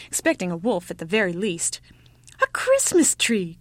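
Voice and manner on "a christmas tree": slow, high, loud announcement; excited cry. A narrator's reading. Ugly child points out the fir, excited cry. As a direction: draw it out with delight. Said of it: "cried the ugly child".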